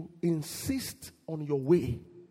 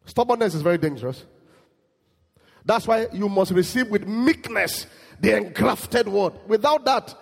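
high, loud, driving pulpit voice; fiery preaching; 10 semitones higher, 7 dB louder than usual. Acted. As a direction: hammer the point home to the congregation. Preaching at full force.

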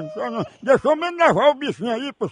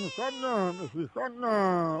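low, soft mock hushing; soothing a baby; 4 semitones lower, 9 dB quieter than usual. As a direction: sink into a pretend lullaby-like aside. Feigns hushing a crying baby.

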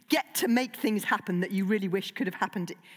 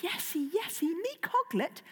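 high, soft little dragon voice; 9 semitones higher, 5 dB quieter than usual. Acted. Toothless answering his owner in a high dragon voice. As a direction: squeak it like a small guilty dragon.

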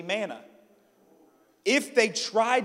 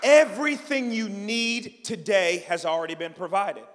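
loud, raised preacher's proclamation; full-force preaching; 5 semitones higher, 9 dB louder than usual. Real, not acted. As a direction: build to a ringing declaration for the congregation.

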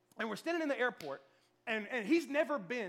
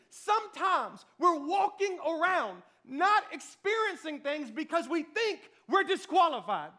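preaching at full force; 7 semitones higher, 7 dB louder than usual. Acted. Pastor preaching to the room about being disqualified, full force. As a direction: drive the point home to the congregation.

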